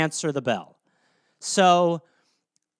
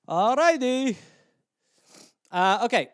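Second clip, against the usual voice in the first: usual speaking voice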